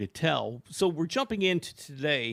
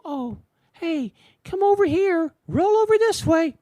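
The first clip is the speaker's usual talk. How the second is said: much higher, sing-song coaxing of a pet; imitating wife coaxing dog; 12 semitones higher, 6 dB louder than usual. Husband imitates his wife sweetly coaxing the dog over, much higher and coaxing. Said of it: "she'll go".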